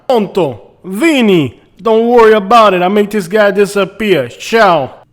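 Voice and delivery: put-on loud New York accent on a call; hammed-up New York tough guy; New Yorker accent. Realtor taking Vinny's call, New York accent. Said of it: "getting a call from Vinny".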